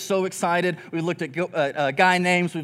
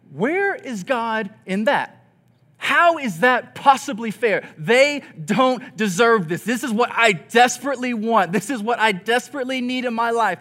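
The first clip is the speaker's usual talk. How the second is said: 1 frustrated plea; 5 semitones higher, 4 dB louder than usual. Retold or imitated. Praying person's frustrated complaint.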